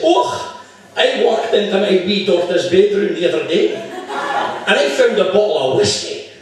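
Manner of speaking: hammed-up Scottish accent. Scotsman telling the barman about his daughter, Scottish accent. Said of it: "The Scotsman says".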